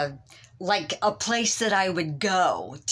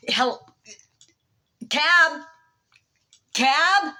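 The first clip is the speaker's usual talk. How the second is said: high, louder calls for a cab; calling out; 7 semitones higher, 3 dB louder, much slower than usual. Retold or imitated. Woman hailing cabs on the street. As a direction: call out to passing cabs.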